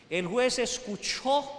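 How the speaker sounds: usual speaking voice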